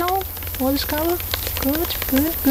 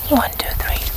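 whispering; faster than usual. Acted. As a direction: whisper the numbers for a hearing test. Whispered hearing test.

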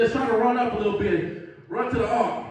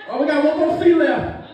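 calling out as Noah; 5 semitones higher than usual. Acted. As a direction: round everyone up before the rain. Plays Noah calling people aboard.

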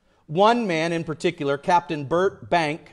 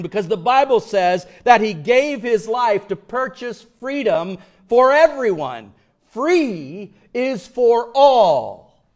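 louder, higher preacher's build; full-force preaching; 5 semitones higher, 5 dB louder than usual. Acted. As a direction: drive it home to the whole congregation.

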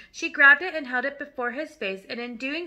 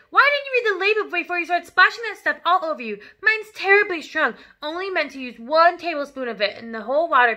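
loud, raised exasperated scolding; scolding wife; 5 semitones higher, 7 dB louder than usual. Acted. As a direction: lecture a stupid husband. Mother berates husband over dye.

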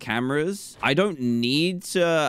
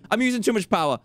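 normal talking voice

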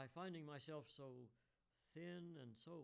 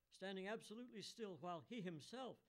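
ordinary delivery

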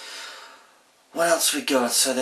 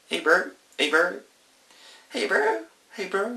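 Kiwi greeting, bright and rising; broad kiwi accent; 10 semitones higher than usual. Shown as a demonstration. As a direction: say it like a New Zealand mate. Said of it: "Kiwi speak".

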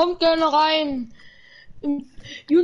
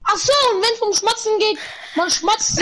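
mock explanation; 7 semitones higher, 3 dB louder than usual. Mock explains loud smacking.

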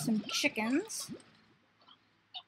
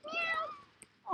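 tiny, very high, soft mew; cat meow; 5 dB quieter than usual. Pretend. Gamer mimicking the cat slimes, kitten meow. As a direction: squeak it like a little kitten.

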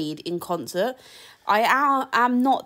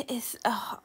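whisper; 4 semitones higher, 8 dB quieter than usual. Acted. Whispered build-up before the name.

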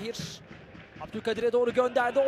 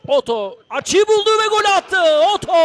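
higher, louder goal shout; screaming football commentator; 6 semitones higher, 5 dB louder than usual. Real, not acted. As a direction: burst out on the goal. Goal call in live commentary. (bağıran futbol spikeri)

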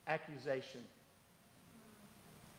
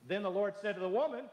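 ordinary talk, nothing marked

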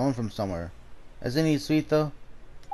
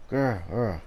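dog growl; 3 semitones lower than usual. Acted.

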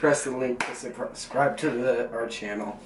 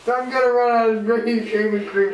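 higher and louder excited cry; 11 semitones higher, 6 dB louder than usual. Real, not acted. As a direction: call it out over the chaos. Shouting over shaving cream mess.

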